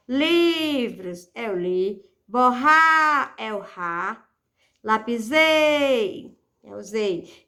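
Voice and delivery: each word said slowly with its stressed syllable lingered on; slow and deliberate, leaning on li, rá, zei; stressed syllables named. Shown as a demonstration. Teacher spelling out each stressed syllable for students, slow. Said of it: "Paroxítona, quando a sílaba tônica for a penúltima".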